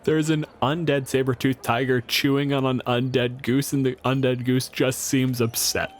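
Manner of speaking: normal talking voice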